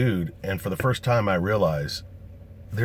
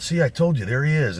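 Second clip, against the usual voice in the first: higher, louder call across the bar; fan yelling out; 7 semitones higher, 5 dB louder than usual. Retold or imitated. Bar patron spotting him, yelling out higher and louder. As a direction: call out triumphantly to your friends. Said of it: "somebody yells out".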